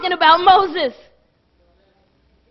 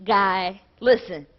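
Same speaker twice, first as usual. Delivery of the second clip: lower, softer voice of God; a low, soft, firm voice of God insisting; God speaking; 7 semitones lower, 6 dB quieter than usual. Acted. Preacher voicing God telling Moses to listen. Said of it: "God said".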